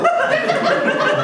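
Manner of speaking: high cackling laugh; gleeful cackle. Acted. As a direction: cackle with delight at the plan. Scrooge's gloating laugh.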